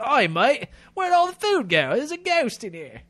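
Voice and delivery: high, loud Australian accent, hammed-up; a bloke in a bad Australian accent asking about his food; mock Australian accent. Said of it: "when I'm trying to be, like, an English person, I sound Australian".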